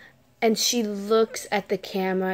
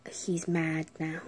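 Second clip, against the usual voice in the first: eerie hush; 3 semitones lower, 7 dB quieter than usual. Retold or imitated. Creepy child warns.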